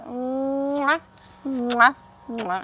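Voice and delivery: three smacking air kisses; blown kisses. Shown as a demonstration. Teacher blowing kisses to her viewers, playful. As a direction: blow kisses to the camera. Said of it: "a nice, beautiful kiss".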